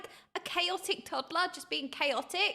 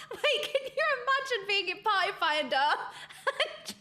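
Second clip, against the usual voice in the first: talking through real laughter; laughing; 7 semitones higher than usual. Real, not acted. Streamer imagining the excuse in Party Finder, laughing. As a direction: barely get it out for laughing. Cracks up at dog food excuse.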